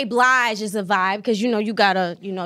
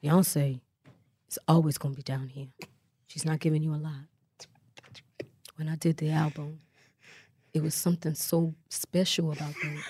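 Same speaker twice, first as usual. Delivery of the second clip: lower, softer [name] voice; [name] impression; 6 semitones lower, 8 dB quieter than usual. Guest answers the host as [name], low and soft. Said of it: "What's [name] sound like?".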